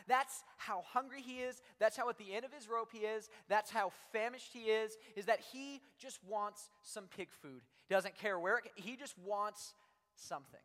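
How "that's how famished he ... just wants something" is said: ordinary delivery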